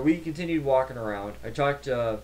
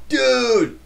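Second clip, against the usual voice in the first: much higher and louder, a happy yell; excited shout; 12 semitones higher, 10 dB louder than usual. Retold or imitated. Running into his study group friend, excited shout. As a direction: call out to your buddy with delight.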